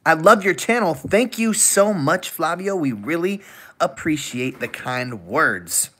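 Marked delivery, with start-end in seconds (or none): none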